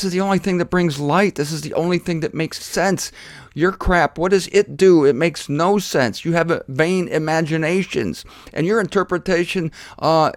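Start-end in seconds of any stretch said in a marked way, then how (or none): none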